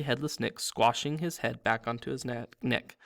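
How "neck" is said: plain reading voice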